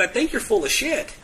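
high, half-laughing blunt retort; giggling at own bluntness; laughing insult. Retold or imitated. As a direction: laugh while saying it.

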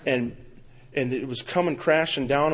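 ordinary talk, nothing marked

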